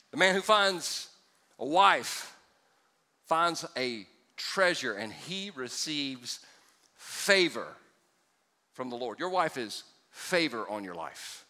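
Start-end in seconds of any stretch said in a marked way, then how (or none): 0.1-9.2 slow, with long pauses between phrases; dramatic pauses. Shown as a demonstration. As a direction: let each phrase land, then wait. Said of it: "I just pause and let that, let that moment sink in".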